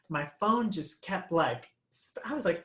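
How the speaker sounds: ordinary talk, nothing marked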